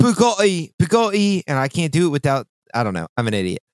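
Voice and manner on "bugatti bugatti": mock British Bugatti. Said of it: "You just say Bugatti a bunch".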